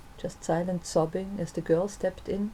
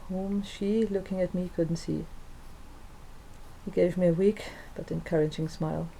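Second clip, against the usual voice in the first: ordinary delivery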